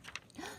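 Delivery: a quick unvoiced gasp of wonder; soft surprised gasp. Reader gasps in wonder at the squirrel, soft gasp. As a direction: gasp softly as if spotting something.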